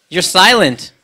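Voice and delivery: normal talking voice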